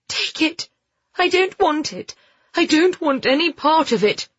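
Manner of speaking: high, frantic pleading; panicked refusal. A narrator's reading. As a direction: desperately push it away, voice pitched high. Shaking woman hands over gun.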